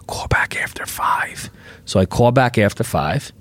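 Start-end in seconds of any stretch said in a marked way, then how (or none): 0.1-1.5 hushed, secretive whisper. Retold or imitated.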